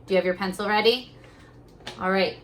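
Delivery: ordinary talk, nothing marked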